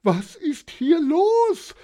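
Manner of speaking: high, loud, drawn-out shriek; scream. A narrator's reading. Wife panicking in the haunted lighthouse, shrieking. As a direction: scream it in panic, stretching the end. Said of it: "schrie Johanna Elisabeth".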